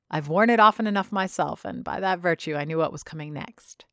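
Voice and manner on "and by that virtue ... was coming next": ordinary delivery, nothing marked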